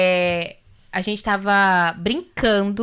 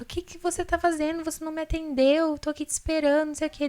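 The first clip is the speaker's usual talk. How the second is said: higher-pitched, restrained nagging rebuke; retold scolding; 7 semitones higher than usual. Wife scolding husband who just got home, retold quarrel. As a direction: re-enact a fretful telling-off, pitched up. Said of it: "já vinha, assim, brigando".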